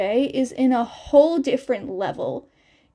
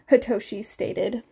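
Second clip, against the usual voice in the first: ordinary delivery, nothing marked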